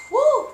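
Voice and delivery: high loud "whoo" of disbelief. Real, not acted. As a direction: let out a shrill exclamation. Exclaiming at harsh karmic lessons.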